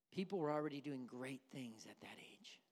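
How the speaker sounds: whispered. Acted. Pastor encourages the children's ministry in a whisper. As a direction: let it fall to a whisper. Hushed close of sermon.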